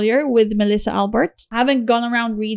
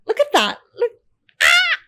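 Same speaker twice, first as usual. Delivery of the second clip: excited. Real, not acted. Excited reveal of illustrations.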